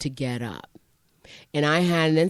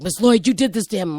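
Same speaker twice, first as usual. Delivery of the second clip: mock accusation; 6 semitones higher, 6 dB louder, faster than usual. Colleagues' accusation acted out.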